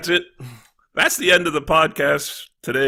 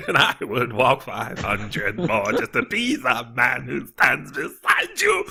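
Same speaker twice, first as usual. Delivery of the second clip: singing in a bad mock Scottish accent, slowly; terrible Scottish accent; 2 semitones lower than usual. Singing the Proclaimers to the podcast group in a bad Scottish accent. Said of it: "your attempt at a Scottish accent? Terrible".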